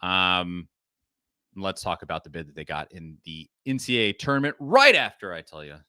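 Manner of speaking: usual speaking voice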